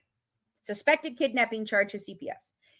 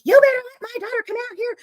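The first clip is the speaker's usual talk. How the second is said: angry mom demand; 10 semitones higher, 4 dB louder than usual. Retold or imitated. Mom pounding on Dan's door.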